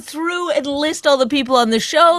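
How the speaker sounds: high-pitched, insistent exasperation; exasperated complaint. Real, not acted. Co-host fed up with a pointless story, exasperated.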